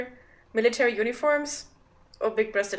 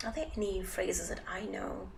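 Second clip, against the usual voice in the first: breathy whispered musing; 9 dB quieter than usual. Real, not acted. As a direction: whisper it to yourself.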